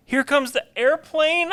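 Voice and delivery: put-on slow sing-song baby feeding; airplane spoon game. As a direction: coax the baby like feeding time.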